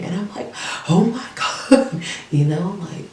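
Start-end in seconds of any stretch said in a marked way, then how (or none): 0.9-2.0 loud, high, drawn-out mock horror; high, slow retold gasp of alarm; retold shock. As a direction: re-enact a shocked outcry, stretched out with a laugh. Reacting to man punching her door.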